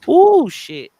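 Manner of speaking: high loud gasp of shock. Real, not acted. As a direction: exclaim in delighted shock. Reacting to juicy gossip.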